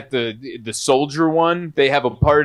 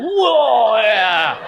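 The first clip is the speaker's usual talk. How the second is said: mock whoa; 6 semitones higher than usual. Mock bottle chug.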